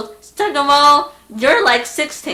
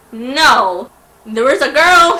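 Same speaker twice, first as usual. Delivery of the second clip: usual speaking voice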